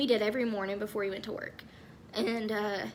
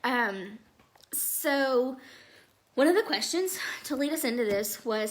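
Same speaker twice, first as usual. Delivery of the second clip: ordinary delivery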